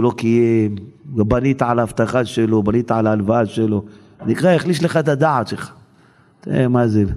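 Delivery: usual speaking voice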